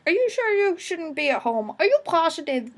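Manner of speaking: high, doubting lecturer voice; concerned lecturer. Retold or imitated. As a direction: play a lecturer asking a sick student to go home. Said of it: "lecturer be like".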